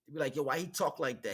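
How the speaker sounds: usual speaking voice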